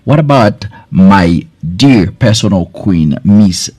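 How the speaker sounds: normal talking voice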